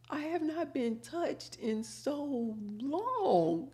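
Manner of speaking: high, soft, slow mock crying; imitated tearful voice. Prison inmate to hairdresser, imitated tearful high voice. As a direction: draw it out in a high, weepy put-on voice. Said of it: "she literally starts crying".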